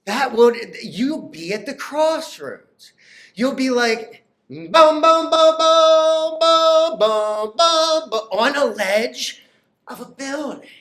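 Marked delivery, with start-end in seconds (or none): 4.5-8.2 louder, higher booming beats; pounding boom. Acted. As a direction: pound each boom high and loud. Pounding heart at crossroads.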